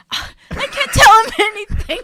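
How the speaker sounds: high, loud burst through laughter; laughing. Real, not acted. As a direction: crack up while getting the words out. Cracking up over a slip.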